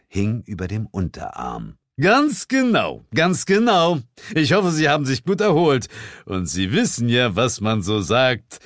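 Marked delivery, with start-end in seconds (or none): 2.0-8.6 smug boss. Acted. Sir James ends the holiday smugly. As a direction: savour the last line.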